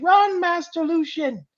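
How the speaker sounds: high, slow pleading cry; werecat character voice. Shown as a demonstration. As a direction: plead for him to run. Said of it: "the female werecat is saying".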